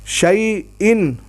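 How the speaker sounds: شیئن drawn out slowly; slow shayin. Shown as a demonstration. Teacher slows down the word for the pupil. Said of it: "یہ آپ دیکھیں".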